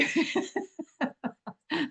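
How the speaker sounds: real laugh breaking through; brief amused chuckle. Real, not acted. Laughs at her own parody.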